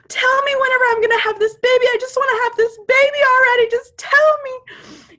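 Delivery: retold high, loud, rushed plea; desperate yelling. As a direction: yell it out fast and high, begging for an answer. Retold desperate outburst in labor.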